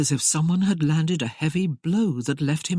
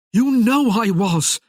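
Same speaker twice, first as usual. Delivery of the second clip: loud, slow, higher fiery insistence; fiery declaration; 6 semitones higher, 7 dB louder, slower than usual. Acted. Merlin insisting to Tamara that she knows him, loud and fiery. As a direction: declare it fiercely, stung. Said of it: "he declared fierily".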